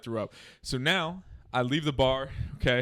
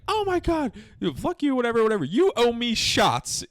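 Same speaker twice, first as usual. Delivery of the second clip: high falsetto telling-off; mock a furious girl in a squeaky high voice; imitated angry girl; 11 semitones higher, 4 dB louder than usual. Imitating the furious girl confronting him.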